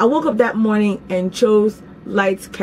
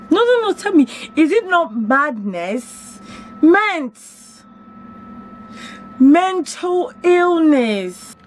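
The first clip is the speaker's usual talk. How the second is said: incredulous exclaim; 9 semitones higher, slower than usual. Real, not acted. Exclaiming at her own madness.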